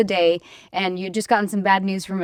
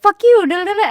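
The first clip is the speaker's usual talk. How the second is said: high, loud mock outburst; hammed-up combative retort, pitched way up; digging in angrily; 11 semitones higher, 7 dB louder than usual. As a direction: lash out, then rattle into nonsense. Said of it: "I will dig in and be like".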